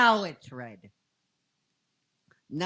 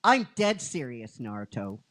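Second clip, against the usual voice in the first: teasing Ino; 5 semitones lower, 3 dB louder than usual. Retold or imitated. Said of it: "she goes".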